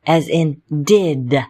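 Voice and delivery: did said slowly, both sounds clear; slow demo of did. Shown as a demonstration. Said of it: "make the sound I and the sound D".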